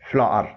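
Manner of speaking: فلار run together as "flaar"; correct blended pronunciation. Shown as a demonstration. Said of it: "اس کو ملا کے پڑھیں گے".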